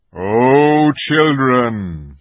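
joy swelling; lion rousing. A narrator's reading. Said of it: "said the lion".

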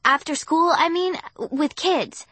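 high, loud, flustered halting; stammering. Acted. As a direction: fumble for an excuse. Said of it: "I was stammering like an idiot".

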